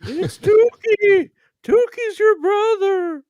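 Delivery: high, slow, drawn-out shriek; shrieking spirit voice. Acted. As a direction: shriek the name like a ghoul.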